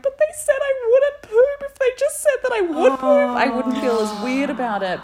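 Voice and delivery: high mock outrage; distressed protest. Distressed inner thought retold.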